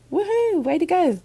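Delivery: high loud cheering; excited cheer. Real, not acted. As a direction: cheer them on. Cheering the four winners.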